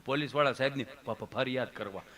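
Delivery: soft, low stammerer; Gabho. Acted. Friend wanting to complain about police, low and stammering. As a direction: falter on the word, low and soft. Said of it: "ઈ કે, કે".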